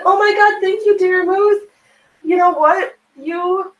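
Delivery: real excited gushing; thrilled thanks. Real, not acted. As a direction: gush thanks at the top of your voice. Thanking follower for first rant.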